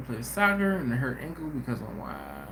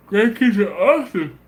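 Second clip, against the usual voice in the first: loud happy exclaim; 5 semitones higher, 9 dB louder than usual. Real, not acted. Joyful exclaim.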